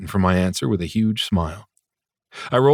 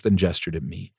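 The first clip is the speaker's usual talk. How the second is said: ordinary delivery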